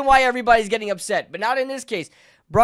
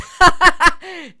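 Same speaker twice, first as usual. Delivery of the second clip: high, loud burst of disbelieving laughter; a fan hearing the benching news, laughing; incredulous laugh; 10 semitones higher, 9 dB louder than usual. Real, not acted. Reacts to the benching.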